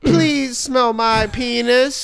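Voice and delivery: laughing mock plea, high; pleading. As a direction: beg up high through laughs. Pleading bit replayed, laughing.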